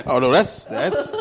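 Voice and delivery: high, loud laughing reply. Real, not acted. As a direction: laugh through the words. Laughing at taxes joke.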